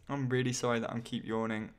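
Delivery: ordinary talk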